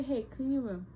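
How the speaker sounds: normal talking voice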